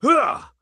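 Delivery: high piercing scream; void scream. Pretend. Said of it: "Let's do Scream into the Void Unhinged".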